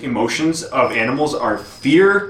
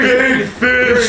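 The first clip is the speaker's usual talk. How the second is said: dumb shark voice; 11 semitones higher, 5 dB louder than usual. Pretend. Voicing shark brain.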